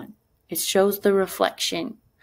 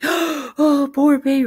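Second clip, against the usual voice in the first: put-on high, louder gasped baby talk; coddling parent; 9 semitones higher, 6 dB louder, slower than usual. Teacher mocking a parent fussing over a toddler. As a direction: fuss over a fallen toddler. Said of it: "you don't wanna be like".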